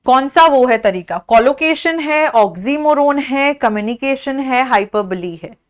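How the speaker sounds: ordinary talk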